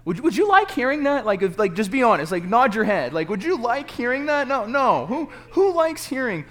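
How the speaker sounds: high, loud, pressing shout; shouting to the crowd. Real, not acted. Preacher asking the congregation if they'd like it, shouting.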